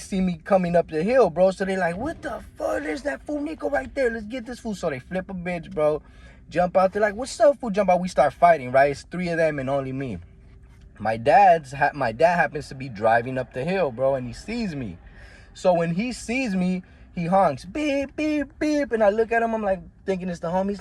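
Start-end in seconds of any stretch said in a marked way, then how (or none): 2.0-4.7 high, hushed thug voice; imitating enemies. Enemies spot him, voiced. 7.1-7.7 high, loud gang challenge; mock challenge. Enemies challenge him. 17.7-18.9 high beeping honk; imitating a car horn.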